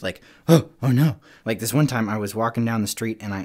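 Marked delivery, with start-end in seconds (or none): 0.5-1.2 loud, higher mock fright; really spooked. Showing the audience a real fright, mock spooked. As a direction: act spooked with a startled gasp. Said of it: "like, really spooked".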